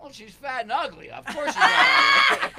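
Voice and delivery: loud, high, laughing comeback; mock shouted insult. Comic shouting down a heckler from the stage. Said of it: "He looks over at her and goes".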